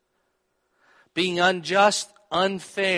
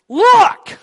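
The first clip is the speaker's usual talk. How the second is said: sudden loud burst, much higher; angry shout; 16 dB louder than usual. Acted. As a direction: bark it like a furious son. Angry son confronting father.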